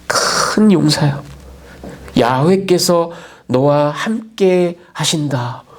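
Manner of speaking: slow, weighty divine pronouncement; slow solemn blessing; solemn proclamation. Acted. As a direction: draw out each word like a heavenly messenger. Angel's solemn announcement.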